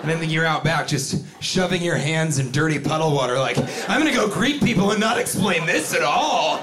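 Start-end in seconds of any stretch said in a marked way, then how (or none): 3.9-6.6 higher, swooping gleeful declaration; mock schemer. Puddle schemer planning to greet people, high mock glee. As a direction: play the plotter, pitch bouncing up. Imagined schemer talking to self.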